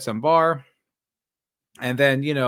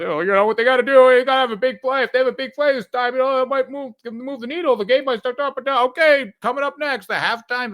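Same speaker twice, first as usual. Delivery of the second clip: much higher, louder hammed-up commentator; mock sports commentator; 9 semitones higher, 5 dB louder than usual. Streamer parodying analysts filling airtime, hammed-up hype. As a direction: play a hyped TV analyst stalling for time.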